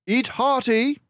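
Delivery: high, loud, brisk detective voice; a clever mouse sleuth, pleased with himself; Basil the mouse detective. A narrator's reading. Narrator voicing Basil to Dawson, high and loud. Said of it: "advised my friend".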